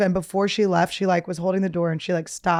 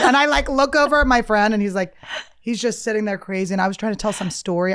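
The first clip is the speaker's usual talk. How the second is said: normal talking voice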